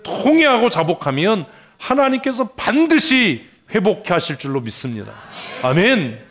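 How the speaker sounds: loud, high declaration of faith; forceful preaching. Real, not acted. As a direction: drive it home to draw an amen. (아멘을 끌어내는 크고 높은 선포)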